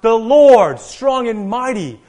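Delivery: loud, high proclamation, hammering each title; triumphant shout. Retold or imitated. David and the procession answering the watchman, triumphant shout. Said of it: "all in a loud, triumphant voice, shout out".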